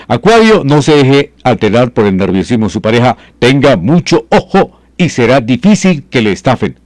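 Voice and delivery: high, bright announcer read of the forecast; lifted, presenter-style horoscope announcement. Acted. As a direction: read the sign like a radio astrologer. Rereads the Acuario forecast.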